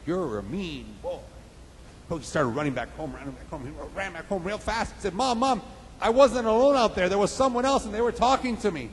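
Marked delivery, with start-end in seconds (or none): 0.0-1.2 soft, slow echo; distant echo. Acted. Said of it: "about the echo". 5.1-8.9 put-on higher-pitched boy's report; excited little boy. Boy runs home to mother.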